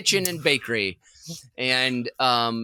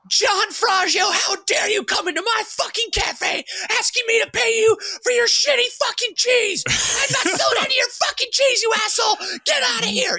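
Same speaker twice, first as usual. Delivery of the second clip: much higher, louder furious mock shout; screaming boss; 3 dB louder than usual. Boss screaming at vendor over unpaid cheese, furious mock shout. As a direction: rant at him like an unhinged cafe owner. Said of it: "to scream at a vendor".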